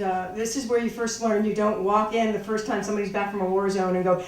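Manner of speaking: normal talking voice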